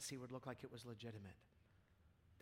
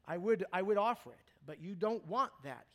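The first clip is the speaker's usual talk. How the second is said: higher, much louder pleading cry; David's anguished plea; 5 semitones higher, 13 dB louder than usual. Retold or imitated. Preacher voicing David confessing to God, anguished loud plea. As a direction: cry out like a broken king begging. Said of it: "David goes".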